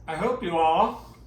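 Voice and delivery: talking through a mouthful of food; words round a bite of shrimp; mouth full. Real, not acted. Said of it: "now I'm gonna talk with my mouth full".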